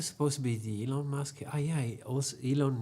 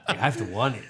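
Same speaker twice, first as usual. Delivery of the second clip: laughing; 8 dB louder than usual. Real, not acted. Said through laughter.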